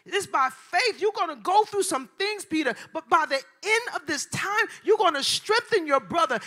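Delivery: loud, high, driving sermon delivery; full-force preaching. Real, not acted. Preacher urging the congregation that God can use them, shouted full force. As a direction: preach it at a shout, building on each promise.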